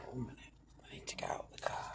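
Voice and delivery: very faint, quick whisper; barely audible self-talk; self-soothing whisper. Real, not acted. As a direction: whisper it hurriedly to yourself. Talking herself down in car.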